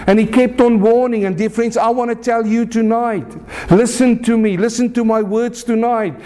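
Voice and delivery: high-pitched, insistent sermon warning; impassioned preacher driving the warning home; urgent preaching. Real, not acted.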